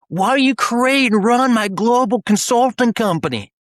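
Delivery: high, slow holler in mock Australian accent; British-Australian accent. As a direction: imitate an Australian calling out, drawn out and loud. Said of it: "his little British slash, you know, Australian accent".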